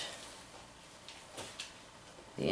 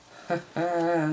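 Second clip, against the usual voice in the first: laughs; 11 semitones higher, 4 dB louder than usual. Real, not acted. Soap maker laughing at mishap.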